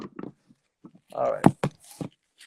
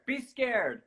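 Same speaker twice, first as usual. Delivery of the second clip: scared, softer; 8 semitones higher, 5 dB quieter than usual. Shown as a demonstration.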